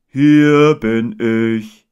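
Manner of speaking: loud, very drawn-out grumbly bear; brummige Bärenstimme. A narrator's reading. Bear answering from behind the boy, slow and grumbly. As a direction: growl it out slowly. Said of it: "sagte eine brummige Stimme hinter ihm".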